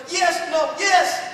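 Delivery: high, loud flip-flopping; torn father. Acted. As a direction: blurt each answer, unable to decide. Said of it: "he kinda waffles a little bit".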